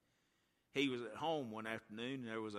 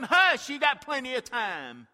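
brushing him off, louder and slower; mother dismissing her son, loud and slow; dismissive hush; 13 semitones higher, 19 dB louder, slower than usual. Acted. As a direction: wave the child away impatiently. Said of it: "And I told him".

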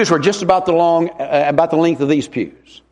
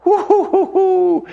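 high whooping singer imitation, a laugh at the end; imitated soaring singer; 13 semitones higher, slower than usual. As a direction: whoop up high like the lady singing. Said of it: "she sung".

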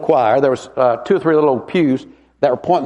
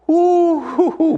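high whooping imitation of a trained singer; imitated soaring singer; 11 semitones higher, much slower than usual. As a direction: whoop up high like her voice taking off. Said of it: "she opened her mouth".

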